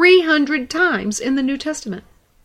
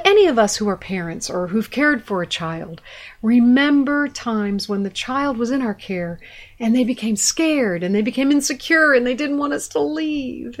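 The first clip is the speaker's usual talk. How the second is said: usual speaking voice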